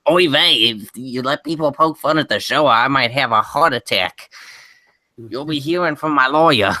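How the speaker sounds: lightly put-on Yiddish accent; a hint of a Yiddish lilt.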